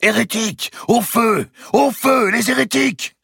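very high, loud cries; crowd-style shouting. A narrator's reading. Monks behind the tribunal denounce the Templars, shouting. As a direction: yell it out in short bursts. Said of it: "se mirent à crier".

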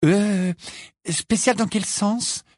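put-on high, hesitant girl's question; timid. A narrator's reading. Pupil asks Hagrid about his creatures, timid. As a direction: ask cautiously, in a high voice. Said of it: "demanda timidement Hermione".

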